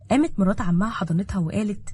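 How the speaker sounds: ordinary delivery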